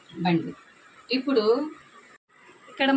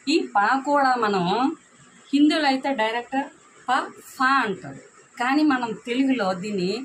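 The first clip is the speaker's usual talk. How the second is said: raised voice to stress the letters; emphatic teaching explanation; 5 semitones higher, 3 dB louder than usual. Shown as a demonstration. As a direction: firmly explain the difference from Hindi. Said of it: "దీన్ని కూడా నొక్కి పెట్టి". (అక్షరాల ఉచ్చారణను నొక్కి చెప్పడం)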